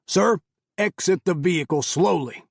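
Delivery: high, loud barked command; barked order. A narrator's reading. Carl ordering Hamid out of the car, loud bark. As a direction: snap the order out hard. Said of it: "Carl barked again".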